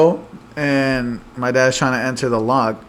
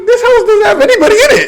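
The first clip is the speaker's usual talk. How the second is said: much higher and louder, rushed protest; high-pitched exasperated retort; 5 dB louder, faster than usual. Retold or imitated. Telling off nosy neighbours, high exasperated protest. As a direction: push the retort way up high, fast.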